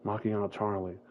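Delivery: ordinary talk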